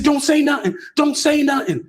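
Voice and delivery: retold frightened plea to stay silent; imitating a scared plea. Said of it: "He in the corner screaming".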